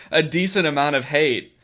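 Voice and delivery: usual speaking voice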